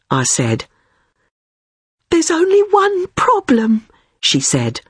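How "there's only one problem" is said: very high, uneasy admission; high-pitched confession. A narrator's reading. Friend admitting one problem to narrator, high-pitched and uneasy. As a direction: admit the snag in a small high voice.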